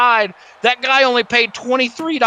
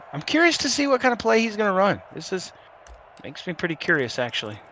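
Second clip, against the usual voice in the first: hushed; 13 dB quieter than usual. Real, not acted. Quiet suspense before the play.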